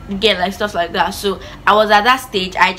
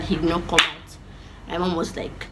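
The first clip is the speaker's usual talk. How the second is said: plain reading voice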